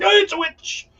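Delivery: high-pitched mocking cry of alarm; mock accusing villager. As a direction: shriek it like a frightened villager. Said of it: "people were like".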